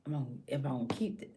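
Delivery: hushed, acted self-questioning; asking herself. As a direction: murmur the questions privately. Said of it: "if she asks herself".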